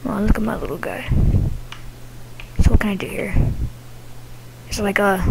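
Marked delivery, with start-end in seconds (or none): 2.6-3.3 whispering. Real, not acted. Whispering while playing.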